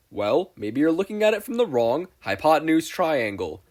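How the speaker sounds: high, loud huckster pitch; glitchy pitchman announcing himself; Spamton salesman voice. Acted.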